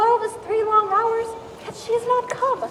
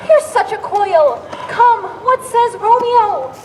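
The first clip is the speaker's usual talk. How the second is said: exasperated demand; 2 semitones higher, 5 dB louder, slower than usual. Acted. Juliet pressing the stalling nurse about Romeo, exasperated. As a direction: demand the answer, fed up with stalling.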